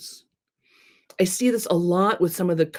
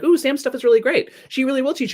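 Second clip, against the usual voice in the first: gushing fan; 8 semitones higher than usual. Retold or imitated. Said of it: "other comments on it of like".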